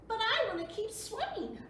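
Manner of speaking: put-on high, loud little-girl plea; girl begging to stay in the pool; child pleading.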